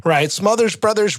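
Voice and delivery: ordinary delivery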